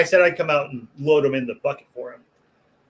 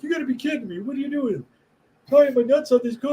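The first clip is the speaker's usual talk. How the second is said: high-pitched imitation of outraged disbelief; mock incredulous; 11 semitones higher than usual. Prank victim confronting the butcher who did it, mock incredulous. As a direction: act out his disbelief, pitched way up. Coworker finding the prank, acted.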